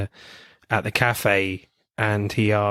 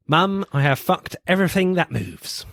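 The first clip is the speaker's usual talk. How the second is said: higher, defiant quote; 8 semitones higher than usual. Retold or imitated. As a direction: fling it at his mum. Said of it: "he says to his mum".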